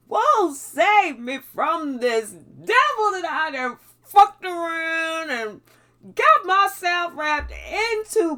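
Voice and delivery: desperate prayer. Retold or imitated. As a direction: pray out loud like you're drowning.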